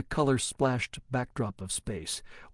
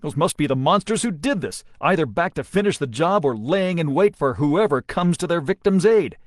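building to a loud, high shout; rising angry shout; 5 semitones higher, 6 dB louder than usual. A narrator's reading. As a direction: grow louder as anger rises. Deming angry in battle.

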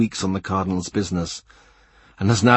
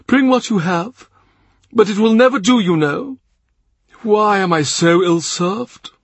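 much higher, louder, theatrical prelate; the Cardinal, mock dismay; 11 semitones higher, 5 dB louder than usual. A narrator's reading. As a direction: play up the fuss over cherries. Cardinal continues complaining.

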